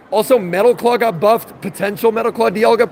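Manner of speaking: usual speaking voice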